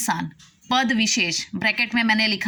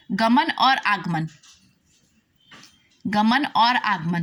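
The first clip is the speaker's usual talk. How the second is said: slow dictation; slower than usual. Shown as a demonstration. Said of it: "विग्रह करेंगे तो".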